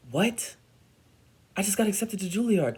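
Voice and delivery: high, fast burst of disbelief; stunned excitement. Retold or imitated. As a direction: blurt the big news, high-pitched and rushed. Retold stunned reaction to acceptance call.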